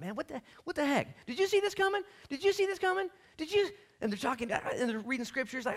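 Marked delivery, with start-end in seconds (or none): 0.0-3.7 very high, exasperated squabbling; acted arguing. Two disciples arguing on the road, high-pitched disbelief. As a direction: bicker in a high, incredulous voice. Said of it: "They're arguing about it".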